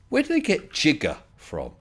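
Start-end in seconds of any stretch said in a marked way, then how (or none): 0.7-1.2 "jigger" begun with a J sound; soft G. Shown as a demonstration. Asking where the film got it, "jigger" with soft G. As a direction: say "jigger" with the G of giant. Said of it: "It was a mispronunciation".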